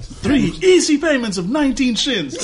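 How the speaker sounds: high, laughing infomercial pitch; home shopping host. Acted. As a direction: tack on the price like a TV salesman. Joins the infomercial bit.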